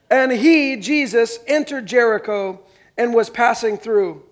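loud, slow and deliberate Bible reading; scripture reading. Acted. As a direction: read the passage out to the congregation, slowly and loudly. Pastor reads Luke 19 aloud.

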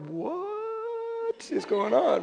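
high, hugely drawn-out "what"; comically slow, high-pitched bewilderment; stunned disbelief. Retold or imitated. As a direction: stretch it out in baffled shock. Retelling his shocked reaction.

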